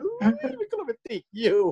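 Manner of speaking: high, mock-romantic squeal; mock swoon. Joking about the snoring scene, mock-romantic squeal. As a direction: gush like it's so romantic.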